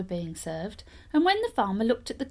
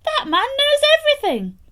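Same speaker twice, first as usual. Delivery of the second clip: shrieking, much higher and louder; in fright; 10 dB louder than usual. Acted. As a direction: jump out of the stove in terror. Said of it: "jumped out of the stove in fright".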